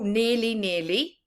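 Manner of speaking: ordinary delivery, nothing marked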